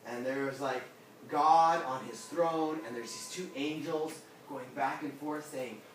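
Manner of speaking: usual speaking voice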